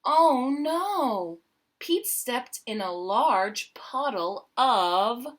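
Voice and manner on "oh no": usual speaking voice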